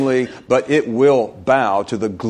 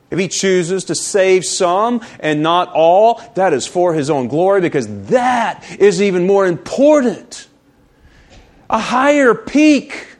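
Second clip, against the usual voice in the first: emphatic sermon climax; forceful preaching; 6 semitones higher, 4 dB louder than usual. Real, not acted. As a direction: preach it hard, rising to the final phrase.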